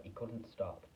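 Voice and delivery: plain reading voice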